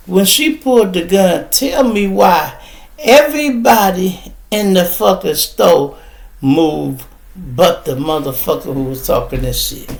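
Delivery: ordinary delivery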